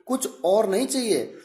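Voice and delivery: ordinary delivery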